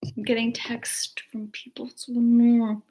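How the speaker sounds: annoyed whisper off to the side; whispered aside. Real, not acted. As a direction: mutter about the texts under her breath.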